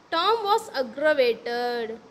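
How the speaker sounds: usual speaking voice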